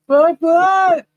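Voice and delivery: high shouted disbelief; shocked. Real, not acted. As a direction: blurt it out in shock. Shocked reaction.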